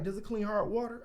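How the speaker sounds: put-on high-pitched, keen older homeowner; energetic friendly old man. Homeowner quizzing a door-to-door salesman, energetic and friendly. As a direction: imitate a nice old customer's curious question. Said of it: "energetic, like, nice as hell".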